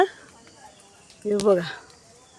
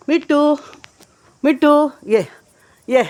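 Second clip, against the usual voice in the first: high, louder coaxing call; calling the parrot; 7 semitones higher, 4 dB louder than usual. Real, not acted. Woman coaxing her pet parrot Mittu to come, high calling voice. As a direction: call the bird over sweetly, up high.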